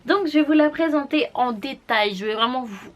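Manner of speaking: ordinary talk, nothing marked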